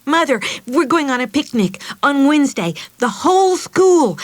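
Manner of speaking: high, loud, fast excited chatter; bubbling excitement. Acted. Meg tells Mother about the school picnic, excited. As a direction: gush it all out at once. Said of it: "she didn't stop talking".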